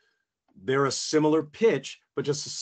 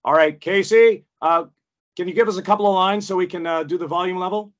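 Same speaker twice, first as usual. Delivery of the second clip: brisk, higher-pitched booth direction; studio staff; 5 semitones higher, 6 dB louder, faster than usual. Retold or imitated. Studio staff asking the actor for a level check, brisk and businesslike. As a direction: call over to the talent, louder at first. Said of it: "and they go".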